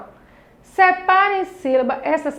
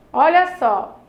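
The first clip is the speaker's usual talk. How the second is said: ordinary delivery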